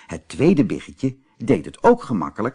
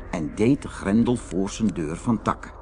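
ordinary delivery, nothing marked

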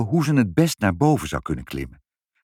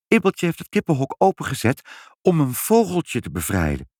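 high, earnest defence; lightly voiced protesting mother; pleading mother; 6 semitones higher than usual. A narrator's reading. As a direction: plead the child's case in a raised pitch. Mother pleading for her daughter.

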